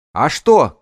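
loud, high shouted retort. A narrator's reading. Woman leader answers the hidden lad, high loud shout. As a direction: call back across the distance. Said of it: "крикнула она".

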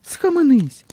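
put-on very high little girl character; high-pitched scolding exclamation; Romashka. A narrator's reading. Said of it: "Ромашка мовить". (високий дівчачий голосок, з докором)

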